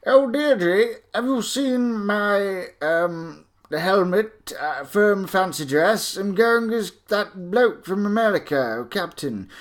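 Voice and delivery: high-pitched mock toff voice; proper toff. Toff asking Deirdre about fancy dress, hammed-up posh voice. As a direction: put on a hammy upper-class toff. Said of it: "looks like a toff".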